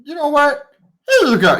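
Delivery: put-on a spoiled young king gushing at his servants; gushing boy king. Young king gushes over his servants, high and eager. King NPC gives away keys.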